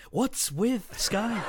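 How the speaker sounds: high, drawn-out stand-up comic's gripe; hammed-up sitcom comedian, stretched slow and high; Jerry Seinfeld. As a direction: whine it like an observational comedian, laughing. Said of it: "I'm Jerry Seinfeld, by the way".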